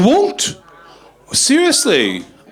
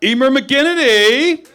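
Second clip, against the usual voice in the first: hyped-up compere impression; 10 semitones higher than usual. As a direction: pump up the crowd like a cheesy host. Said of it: "I just did a really good impression of somebody".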